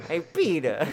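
high, loud cartoon Lois; quick confident bad Lois; hammed-up Lois voice; Lois from Family Guy. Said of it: "Lois from Family Guy".

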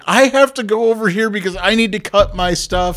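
high, loud frantic contestant. Retold or imitated. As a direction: panic like a rushed contestant. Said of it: "So they're constantly like".

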